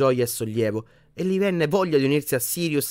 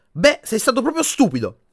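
much higher and louder telling-off; angry; 12 semitones higher, 8 dB louder than usual. A narrator's reading. Ginny scolding Harry for hiding, angry. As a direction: scold him hard. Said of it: "replicò Ginny arrabbiata".

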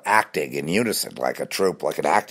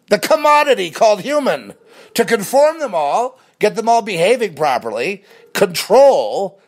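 high, loud, building tirade; rising rant; 12 semitones higher, 6 dB louder than usual. Real, not acted. Ranting to viewers about society conforming people. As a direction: climb into a fierce rant.

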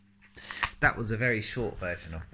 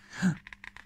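mock gasp; 11 semitones higher than usual. Reacting to beans thrown out.

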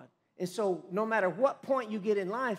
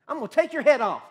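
loud, high battle taunt; a fierce defiant yell; David's taunt; 7 semitones higher, 9 dB louder, faster than usual. Retold or imitated. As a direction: shout it down at the giant.

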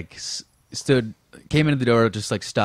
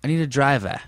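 higher Boston take, "driver" ending in open "ah"; Boston accent; 5 semitones higher than usual. Shown as a demonstration. Comparing two towns' speech, Boston accent on "driver". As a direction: end "driver" on a wide "ah", Boston style. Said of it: "That's Boston".

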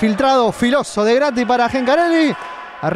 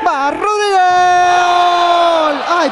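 higher shouted goal call; 6 semitones higher, 4 dB louder, much slower than usual. Acted. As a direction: belt out the goal. Goal called in commentary.

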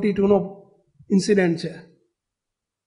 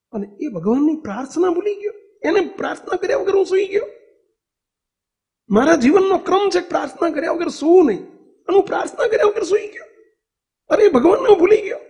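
retold lament, pitch rising higher, voice catching; tearful regret; 8 semitones higher than usual. Preacher retells Gandhi's midnight lament to his companion, mildly tearful. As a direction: voice Gandhi's grief at forgetting his prayer, lightly tearful. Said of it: "ધ્રુસકે ને ધ્રુસકે રડી પડ્યા".

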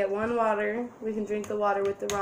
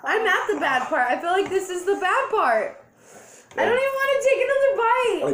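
distressed whining; 8 semitones higher, 5 dB louder than usual. Real, not acted. Suffering from spicy ramen.

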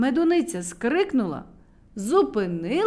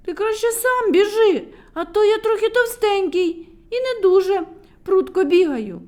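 chubby Ponchyk; 10 semitones higher, 8 dB louder than usual. Acted. Ponchyk excuses himself.

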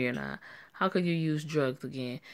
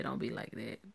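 softer, sneering mock of the plea; mocking imitation; 4 semitones lower, 9 dB quieter than usual. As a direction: parrot the line with scorn. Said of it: "favorite shit to say".

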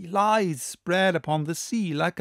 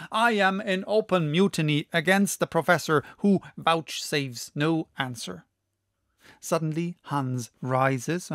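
ordinary delivery

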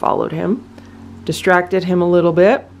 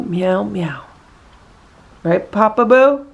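pet talk. Real, not acted. Coaxing the cat to behave.

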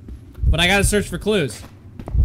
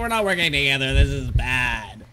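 whiny complaint; 9 semitones higher than usual. Real, not acted. Whining at the game.

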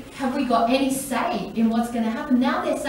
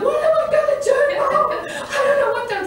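very high, loud panicked daughter; freaking out; 4 dB louder, faster than usual. Retold or imitated. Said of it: "my daughter's freaking out".

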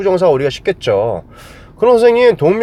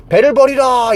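navy order; 8 semitones higher than usual. Retold or imitated. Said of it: "해적들한테 배를 뺏기게 나면".

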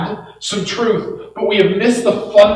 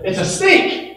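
high-pitched acted exclamation; mock discovery; 9 semitones higher than usual. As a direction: imitate a blind man's sudden guess. Acts out blind man's discovery.

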